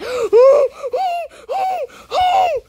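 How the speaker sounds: sharp high gasp breaking into a laugh; startled gasp and laugh. Real, not acted. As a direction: gasp loudly, then laugh.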